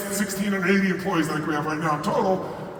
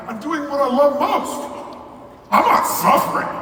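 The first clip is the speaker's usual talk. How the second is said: higher, louder insistence on not; emphatic denial; 7 semitones higher, 4 dB louder, slower than usual. Retold or imitated. Insisting to his friend, loud and emphatic. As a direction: punch the word not, loud and high. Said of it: "I go".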